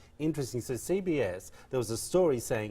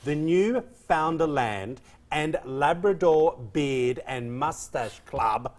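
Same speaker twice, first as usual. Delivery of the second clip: The Newfoundland and Labrador Beard and Mustache Club spelled out slowly, word by word; slow, deliberate announcement; 3 semitones higher, 4 dB louder, much slower than usual. Shown as a demonstration. Host repeating the club name for her co-host, slow and deliberate. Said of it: "Let me just say that again".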